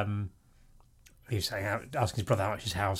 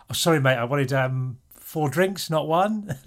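raised, louder pub order of amazed disbelief; mock surprise at the bar; 5 semitones higher, 5 dB louder, much slower than usual. Ordering four drinks at a cheap pub, mock amazed. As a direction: play the baffled Londoner ordering a round. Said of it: "I was like".

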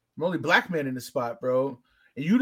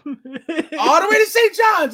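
much higher, slow, loud, teasing emphasis; excited repeat; 11 semitones higher, 8 dB louder, slower than usual. Shown as a demonstration. Hinting at the bar to the host, excited. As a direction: stretch it out, hinting hard. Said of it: "and go left and drive all the way to Saint John's".

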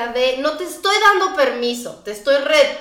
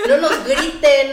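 normal talking voice

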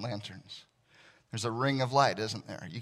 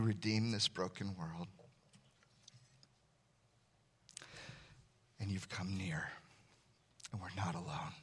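prayer; 2 semitones lower, 7 dB quieter than usual. Acted. Leads closing prayer.

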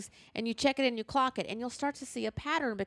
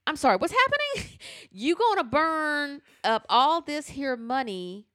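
incredulous mock question; 5 semitones higher, 4 dB louder than usual. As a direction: ask it like you can't believe your ears. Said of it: "And I'm going".

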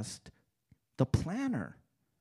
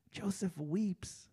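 the line dropped much softer and slower; hushed repeat; 11 dB quieter, slower than usual. Shown as a demonstration. Preacher repeating Joseph weeps to the congregation, hushed and slow. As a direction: let it sink in, barely above a breath.

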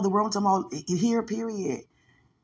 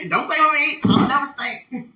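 loud, higher, laughing mock outrage; laughing protest; 6 semitones higher, 11 dB louder than usual. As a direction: protest through laughter, playful.

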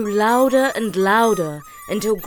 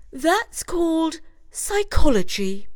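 high, matter-of-fact mouse voice; Judith explaining; 9 semitones higher, 5 dB louder than usual. A narrator's reading. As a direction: lecture the scared mice. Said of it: "explained Judith".

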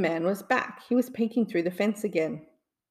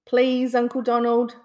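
louder pleading call, a little higher; begging; 4 semitones higher, 5 dB louder than usual. A narrator's reading. Nephews begging their uncle to keep the dog, pleading. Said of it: "begged his nephews".

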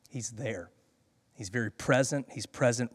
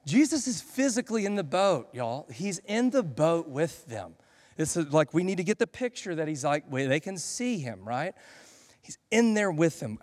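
ordinary delivery

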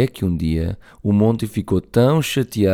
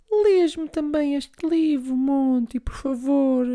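put-on high, slow pleading little girl; sweetly begging her brother, very high; little sister voice; slower than usual. (voz de menina a pedir, muito aguda)

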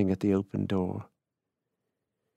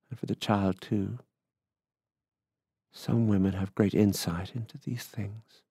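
ordinary delivery

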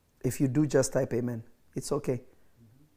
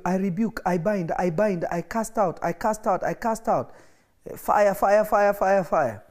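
higher, shouted fire fire like a warfare prayer; mock frantic prayer; 5 semitones higher than usual. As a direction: mimic people binding and casting out in prayer. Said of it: "so many of you".

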